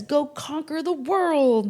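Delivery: rally the troops to conquer; bold Aries voice. Acted. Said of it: "Aries, that cardinal fire sign, says".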